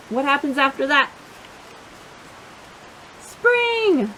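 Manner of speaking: ordinary delivery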